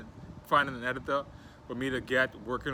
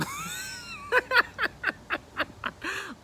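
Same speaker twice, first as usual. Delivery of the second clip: long, squealing laughing fit; high-pitched laugh. Real, not acted. Host laughs at own internet joke, high squeal.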